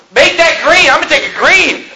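high-pitched, playful bravado; mock defiance. Preacher jokes about repainting the threat sign, mock defiance. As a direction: act out a gleeful little vandal.

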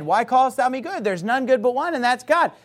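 lightly voiced high, smug objector; mocking an objector. Preacher mimics a critic's smug claim, mock voice. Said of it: "I've had people point to that and say".

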